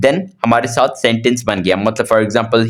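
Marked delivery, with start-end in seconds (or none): none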